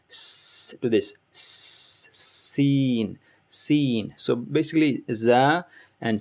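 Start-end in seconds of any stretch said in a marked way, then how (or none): 0.1-0.7 drawn-out s like a snake; snake hiss. Shown as a demonstration. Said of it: "like hissing of a snake". 1.3-2.1 drawn-out s like a snake; snake hiss. Shown as a demonstration. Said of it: "like hissing of a snake".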